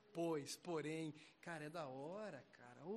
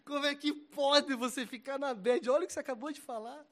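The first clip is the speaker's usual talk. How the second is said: put-on loud, higher exasperated yell at the boy; retold scolding shout; 9 semitones higher, 15 dB louder than usual. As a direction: bark it like a frustrated mentor. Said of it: "eu falei".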